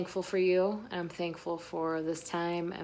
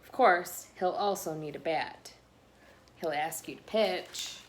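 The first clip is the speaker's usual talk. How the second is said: lively picture-book read-aloud voice; reading to children. A narrator's reading. Reading a picture book to listening children, bouncy and lively. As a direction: read the story to the little ones.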